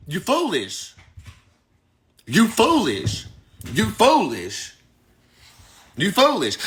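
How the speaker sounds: slow, drawn-out reproach, repeated; real, weighty telling-off; emphatic scolding. Real, not acted. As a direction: drum it in with a pause after each. Scolding the client, repeated four times.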